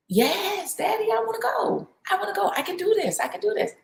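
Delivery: put-on a little girl begging Daddy to let her go; eager daughter. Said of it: "It's like, "Are you sure?".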